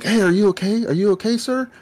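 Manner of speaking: high, loud anxious check on someone; worried friend. Retold or imitated. Little friend asks if he's okay, high and worried. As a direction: pitch it way up, concerned and urgent.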